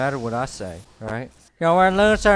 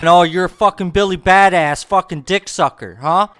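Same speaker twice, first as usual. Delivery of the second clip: trash talk; 2 semitones higher, 10 dB louder than usual. Real, not acted.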